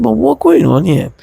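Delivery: high indignant question; offended protest. Retold or imitated. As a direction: demand an answer, indignant and high. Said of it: "I was so offended".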